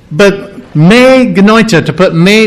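ordinary talk

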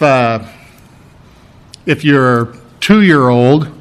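usual speaking voice

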